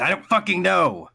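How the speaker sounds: loud, high frustrated yell; exasperated outburst. Acted. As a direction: blow up in mock exasperation.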